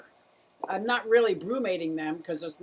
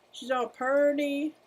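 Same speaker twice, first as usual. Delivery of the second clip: "purty" crooned higher and slow; higher, slower baby-talk "purty"; cutesy coo; 7 semitones higher, slower than usual. Shown as a demonstration. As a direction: coo "She's all purty" like to a pet. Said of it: "See how she's all purty?".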